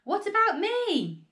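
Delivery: high, loud, demanding call; indignant shout. A narrator's reading. Grandma jealous of the hen, high indignant shout. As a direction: demand the attention back. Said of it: "Grandma shouted from the rooftop".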